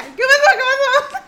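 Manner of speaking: squeaky high mock panic through laughter; panicked mom. Retelling mom waking in a panic, high laughing mimic. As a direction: shriek it like mom jolted awake.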